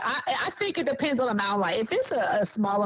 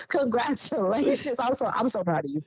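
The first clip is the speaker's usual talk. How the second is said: ordinary delivery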